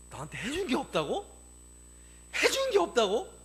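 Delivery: high, loud incredulous echo; acted indignant parent questioning; hurt disbelief. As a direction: throw the words back, rising and wounded. Parent's hurt echo of child's words.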